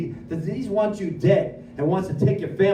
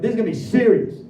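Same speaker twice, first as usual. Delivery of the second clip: higher, building mock gravity; hyped anticipation; 4 semitones higher, 4 dB louder than usual. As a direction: play up the big expectation. Retelling his awed expectation of prayer.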